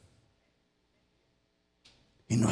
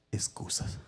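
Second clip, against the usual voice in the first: hushed whisper; dropped to a whisper for effect; 9 dB quieter, slower than usual. Acted. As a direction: breathe the word softly. Preacher hushes the word.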